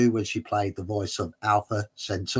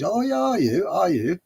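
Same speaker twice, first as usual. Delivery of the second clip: much higher, mock-indulgent questioning; humouring; 9 semitones higher, 3 dB louder than usual. Taxi driver to odd passenger, exaggerated humouring. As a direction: humour him as if he were simple. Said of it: "taxi driver's humouring and says".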